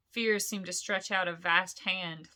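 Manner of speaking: plain reading voice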